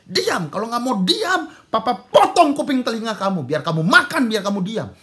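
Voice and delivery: high, full-voiced threatening shout; enraged father. Father threatening his pleading son, enraged shouting. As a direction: roar the threat as the furious dad. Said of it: "Papanya makin marah".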